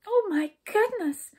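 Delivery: high, slow exclamation of fright; frightened. A narrator's reading. Skip hearing the princess is lost, frightened high voice. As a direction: gasp out the words in high alarm. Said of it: "said Skip in a frightened voice".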